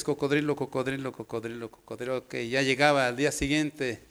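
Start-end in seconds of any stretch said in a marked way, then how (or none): 0.0-2.2 soft chant, fading lower and quieter; rote repetition. Acted. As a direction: rattle it off like a pupil copying lines. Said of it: "y apunta cien veces". (repetición mecánica, cada vez más baja)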